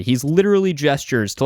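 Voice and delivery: plain reading voice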